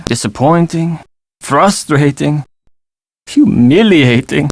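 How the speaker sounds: put-on slow, drawn-out words in a French accent; dejected listing. French skier recalling his Olympic failure, slow and pained. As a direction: weigh out each word slowly, one at a time.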